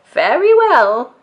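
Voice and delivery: Grandma Tildy. A narrator's reading. Grandma agrees.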